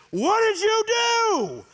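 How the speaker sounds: high, loud, slow frantic yell; yelling at the hurt kid in a panic; shouting at the crying boy over his goose egg; retold yelling at son. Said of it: "And I'm yelling at him".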